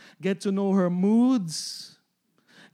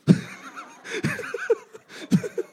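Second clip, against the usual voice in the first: high airy laugh at his own joke; a breathy burst of laughter; laughing at the Tupperware line. Real, not acted.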